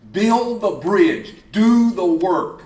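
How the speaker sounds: slow, loud, punched words; slow emphatic command. Acted. Preacher to crowd, slow loud commands. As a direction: drive each word home to the crowd.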